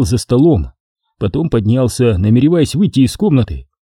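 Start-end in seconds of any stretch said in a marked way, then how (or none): none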